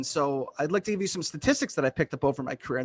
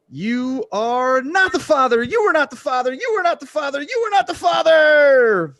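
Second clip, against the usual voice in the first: higher, louder, building to a drawn-out shout; Oprah impression; 10 semitones higher, 7 dB louder than usual. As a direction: Oprah announcing a big reveal to the crowd, escalating each time. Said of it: "This is Oprah hosting the Maury Povich Show".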